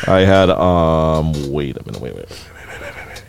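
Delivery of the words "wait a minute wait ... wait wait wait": quiet. Real, not acted. Looking for a clip.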